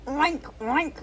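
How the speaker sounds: imitated piggy noises; pig oinks. A narrator's reading. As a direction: oink like a pair of pigs. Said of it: "said Pig and Pig".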